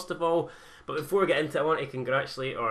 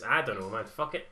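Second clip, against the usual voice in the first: ordinary talk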